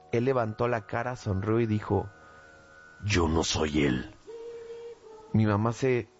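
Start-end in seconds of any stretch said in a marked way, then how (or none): 3.0-4.1 deep, slow voice of someone possessed; an eerie, low stranger's voice from the cousin. Acted. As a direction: drop very low and draw it out. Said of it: "Era una voz más grave y hablaba despacio".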